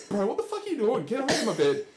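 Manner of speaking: a hint of shouting at an intruder; mock startled yell. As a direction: bark it like a woken stranger. Retold startled bunkmates yelling.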